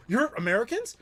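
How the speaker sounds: high, fast and eager; an excited driver who has never met Americans; suddenly excited. Retold or imitated. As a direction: burst into delighted questions. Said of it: "his attitude changed instantly".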